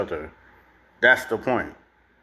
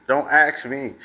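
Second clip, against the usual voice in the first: usual speaking voice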